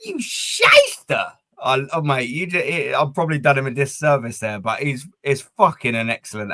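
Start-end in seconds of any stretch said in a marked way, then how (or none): 0.1-1.3 a slow, much higher put-on version of a mate's regional accent; Matty's accent. Imitating a friend's accent for the stream, slow and high. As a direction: drag out the insult in a mate's strong regional accent. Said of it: "He's got, like, the best accent".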